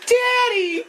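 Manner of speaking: put-on very high girlish call; imitated girl's cry. Girl runs out to her father, high imitated cry. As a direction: squeal it as a mock daughter. Voices girl running out to dad.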